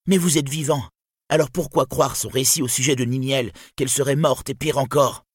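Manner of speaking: high, strident woman's protest. A narrator's reading. Dorlas's wife turning Turin against Brandir, strident. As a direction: cut in with a piercing high voice. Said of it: "s'interposa d'une voix stridente".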